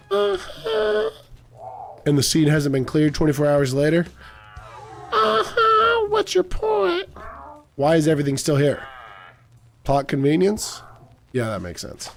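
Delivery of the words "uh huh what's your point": very high, chirpy cartoon cop; high squeaky officer voice. High character answering.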